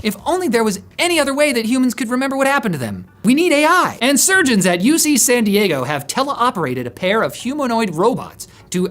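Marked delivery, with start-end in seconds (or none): 0.0-3.1 sarcastic. Real, not acted. Mocking need for AI. 3.2-4.0 sarcastic. Real, not acted. Mock enthusiasm.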